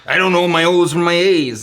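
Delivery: botched Boston impression; Boston accent. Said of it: "I'm gonna do a Boston".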